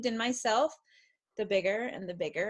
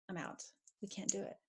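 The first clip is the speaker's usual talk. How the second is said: much softer, deflated quitting; defeated giving up; 2 semitones lower, 15 dB quieter, faster than usual. Retold or imitated. Mother voicing a defeated inner voice, much softer. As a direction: let it sag like you're giving up. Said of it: "the voice that's like".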